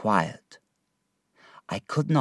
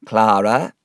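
a firm, louder call of her name; stern order; 5 dB louder than usual. A narrator's reading. Miss Murdstone cutting off the mother, a louder stern command. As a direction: snap her name to stop her. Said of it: "ordered Miss Murdstone".

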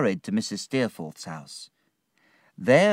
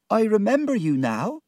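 put-on emotional young woman; 12 semitones higher, 3 dB louder than usual. Martha's voice after her tears.

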